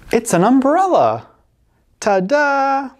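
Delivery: bright, high ta-da announcement; excited reveal. Acted. Mom unveiling her drawing to the boy, excited. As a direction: show off the drawing proudly.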